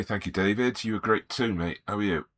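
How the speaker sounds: ordinary talk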